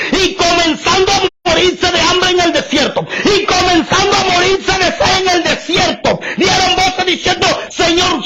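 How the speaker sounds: shouted high preacher cadence with tearful aleluyas; fiery preaching. Acted. Preacher crying out to the congregation, fervent shout. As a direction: preach it out with full fervour.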